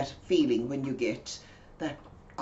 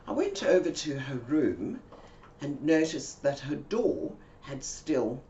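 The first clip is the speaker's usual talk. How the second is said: ordinary talk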